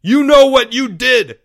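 high, loud accusing yell. Acted. Leo accusing the sobbing Porge, angry yell. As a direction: hammer the suspect with a shout. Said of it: "yelled Leo".

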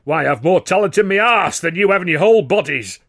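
loud, high, scornful outburst; indignant, blustering contempt; scoffing. A narrator's reading. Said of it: "Weldon scoffed".

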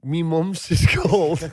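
slow, laughing try at a Scouse line; mock Scouse attempt. Husband tries partner's Scouse phrasing, slow, laughing. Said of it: "if I said".

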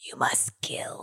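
slow, sinister whisper; whispered command. Acted. Player orders bee to kill, slow whisper.

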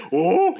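exaggerated yelp of surprise; comic startled "oh". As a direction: do a cartoonish startled "oh". Imitates Jackie's startled comic reaction.

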